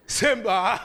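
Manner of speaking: loud booming father's call; Mufasa. Retold or imitated. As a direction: call out like a commanding lion king. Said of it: "and his voice, sh-, like, like Mufasa".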